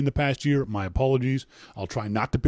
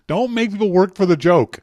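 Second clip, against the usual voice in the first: loud, higher-pitched mock outrage; exasperated complaint; 7 semitones higher, 7 dB louder than usual. Real, not acted. Host scolds Les over his awful joke, exasperated. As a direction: holler it in amused exasperation.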